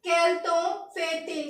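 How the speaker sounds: quel temps fait-il said slowly, kel-tahn-fay-teel; slow French phrase. Shown as a demonstration. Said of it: "French में बोलने के लिए बोलेंगे हम". (quel temps fait-il धीरे-धीरे फ्रेंच उच्चारण में)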